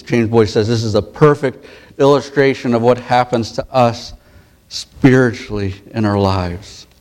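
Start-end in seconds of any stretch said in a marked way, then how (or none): none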